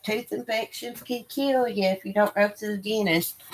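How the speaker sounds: a light take on a worried mother's warning; imitating mom. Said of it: "She's like".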